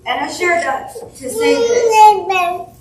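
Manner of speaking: high, loud, tearful cry through the words; voice breaking. Real, not acted. At his memorial, crying out to the gathered family in tears. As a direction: let the grief break through as it rises.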